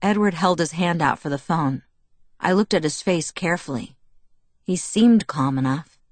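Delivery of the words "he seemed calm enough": ordinary delivery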